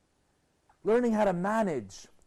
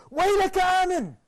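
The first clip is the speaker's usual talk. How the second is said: parents' cry; 13 semitones higher, 7 dB louder, faster than usual. Acted.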